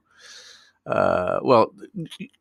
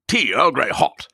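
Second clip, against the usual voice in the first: a mock English-accented starship order; clipped English accent command. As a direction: give the order like an English captain. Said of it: "You've gotta say it in an English accent".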